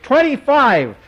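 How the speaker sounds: put-on boy's high, loud shriek. A narrator's reading. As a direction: shriek the bid out. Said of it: "Jimmy shrieked".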